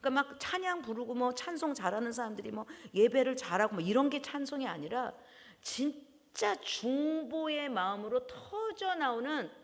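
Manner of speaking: ordinary delivery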